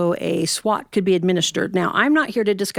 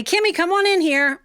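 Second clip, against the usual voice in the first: higher and louder, calling across the house; 10 semitones higher, 4 dB louder than usual. Retold or imitated. Dad calls his daughter to his lap, calling out loudly. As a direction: call the kid in from another room.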